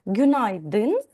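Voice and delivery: "Günaydın" stressed on both Gün and dın; double stress. Shown as a demonstration. Teacher modelling the greeting for students, "Günaydın" with double stress. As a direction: hit the first and last syllables of "Günaydın". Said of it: "подвійний наголос в цьому слові".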